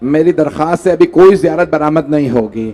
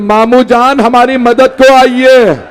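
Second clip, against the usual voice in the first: calling out; 6 semitones higher, 4 dB louder, slower than usual. A narrator's reading. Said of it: "उन्होंने आवाज दी".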